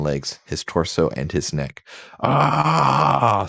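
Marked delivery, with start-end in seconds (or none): 2.2-3.5 a short hammed-up cry of pain, pitched up; pained yell. Logan, shot by Scott, yells in mock fury. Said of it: "screamed Logan with the fury".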